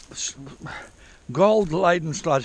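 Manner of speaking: normal talking voice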